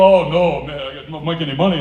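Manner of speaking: loud mock protest; hammed-up vendor; imitating vendor. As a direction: plead like a street vendor.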